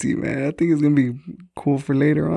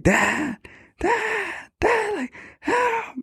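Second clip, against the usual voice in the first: high-pitched, held-back mock yell; imitated yelling; 5 dB quieter, much slower than usual. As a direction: cry out for dad in a thin, high voice, without volume. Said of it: "I was yelling".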